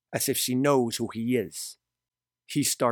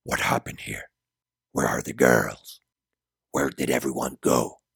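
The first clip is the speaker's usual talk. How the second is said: much lower-pitched, demanding character voice; angry Gabriel; 11 semitones lower than usual. A narrator's reading. Gabriel grabs a stranger to ask about the girls, angry deep demand. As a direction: drop deep to question a man roughly. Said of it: "he grabs him by his clothes and asks".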